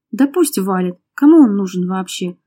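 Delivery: ordinary delivery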